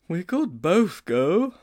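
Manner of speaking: high schoolboy offer; hopeful Sponge. Acted. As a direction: stretch out a hopeful suggestion up high. Sponge suggests going together.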